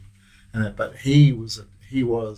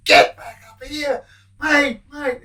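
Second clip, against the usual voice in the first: yelling out; 3 dB louder than usual. Retold or imitated. Prisoner yelling to Latimer to come back, high calling shout. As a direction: bellow his name across the prison. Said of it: "he yelled out".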